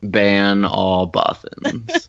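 very slow, each word weighted; slow decree. Real, not acted. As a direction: pronounce it like a verdict. Slow deliberate mock decree.

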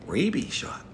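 imitating mom. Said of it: "looks at me and goes".

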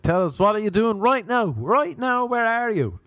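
put-on high, eager Belfast radio host accent; Irish accent. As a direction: pester like a keen Irish DJ. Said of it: "That's the only accent, Belfast or Dublin".

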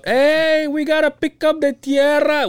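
mock gringo inspector saying tierra; inspector dropping Spanish words. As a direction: ham up a gringo boss tossing in Spanish. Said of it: "drops Spanish words that he knows".

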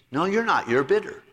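usual speaking voice

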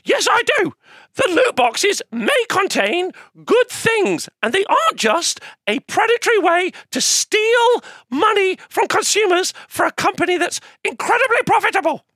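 eager corporate fanboy puppet; Shilly puppet voice. Acted. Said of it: "he's very much the corporate fanboy".

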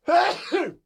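one loud sudden sneeze; real sneeze straight into the mic; sneezing. Real, not acted. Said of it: "I'm sorry I just sneezed in".

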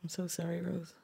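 very soft, tender sympathy; hushed condolence. Real, not acted. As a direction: offer real sympathy, barely above a murmur. Condolence after hearing of friend's death.

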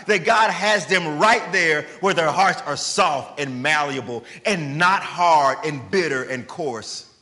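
high, loud preaching build; fervent sermon climax; impassioned preaching. Acted. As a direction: drive the point home from the pulpit. Preaching at full force.

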